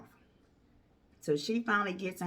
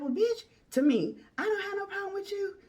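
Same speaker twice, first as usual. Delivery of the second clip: mock angry girlfriend; 13 semitones higher than usual. Girlfriend screaming at him.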